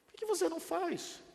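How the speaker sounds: high incredulous plea; high pleading repeat. Acted. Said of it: "repeated challenge".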